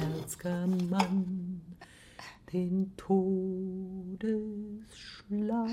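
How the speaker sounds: stockend gedehnt. Acted. Verdächtiger stammelt, stockt.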